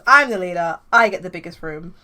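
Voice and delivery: loud, commanding declaration; bossy claim. Acted. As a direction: announce it as leader. Sam claims the room.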